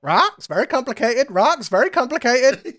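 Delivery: high-pitched put-on character voice; mock character voice. Game master teasingly mimics a complicated explanation, high put-on voice. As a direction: squeak it out high, as a silly character.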